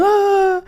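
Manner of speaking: high, drawn-out crying wail; mock loud wail. Shown as a demonstration. Showing how she cried at the union, exaggerated wail. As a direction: bawl out loud for show.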